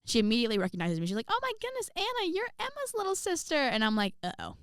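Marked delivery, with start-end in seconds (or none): 1.3-3.5 very high, put-on gushing voice; gushing choir teacher. Choir teacher recognizes a student's little sister, gushing. 4.2-4.5 low, quiet mock-dread uh-oh. Student recognized by her sister's choir teacher, dreading it.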